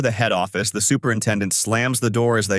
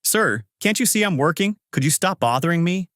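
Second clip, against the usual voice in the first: high-pitched, put-upon complaint; exasperated protest; 7 semitones higher than usual. A narrator's reading. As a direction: brush off the boss, pitch raised. Worker brushes off yelling manager.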